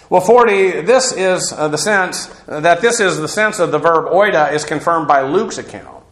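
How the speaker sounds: normal talking voice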